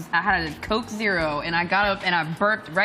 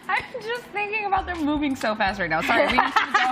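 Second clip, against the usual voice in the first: laughing; 5 semitones higher than usual. Real, not acted. Laughing fit.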